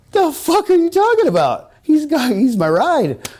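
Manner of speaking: high, loud disbelieving yelp; incredulous outburst. Retold or imitated. To the friend who lost his ride, high incredulous yelp. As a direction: shriek it in stunned disbelief. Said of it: "I go".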